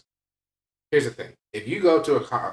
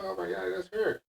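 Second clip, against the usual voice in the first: high, soft fan gasp of recognition; excited fan; 10 semitones higher, 4 dB quieter than usual. Retold or imitated. People spotting the lifter at the airport, excited fan. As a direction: imitate a starstruck stranger, high-pitched. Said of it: "and they're like".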